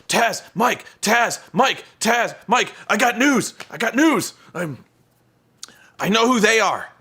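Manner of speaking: a hammed-up pest pestering the announcers; Eric Young impression. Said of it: "I cannot do justice to his fucking annoying voice".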